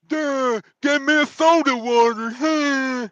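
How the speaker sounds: dopey high mock voice; mocking dumb trucker. Mocking a YouTuber he hates, dopey high voice. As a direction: ham up a slow-witted commentator. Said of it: "It's the worst commentary ever".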